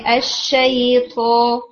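اشئی تھو said slowly with extra breath blowing out; mock the airy, blown sound in اشئی تھو; slow, breathy imitation of the student's mistake; imitating the student's error. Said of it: "تھو ایسی sound آتی ہے".

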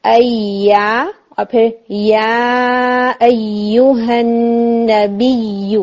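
ایا stretched very slowly from the mid tongue; slow model recitation of ya; correct ya. Shown as a demonstration. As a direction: recite یا ایھا النبی slowly with a crisp ya. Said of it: "یہیں سے آپ یا کو ادا کریں گے".

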